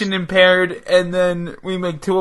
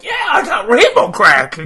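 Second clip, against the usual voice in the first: [name] impression; 8 semitones higher, 4 dB louder than usual. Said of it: "And Takashi's just like".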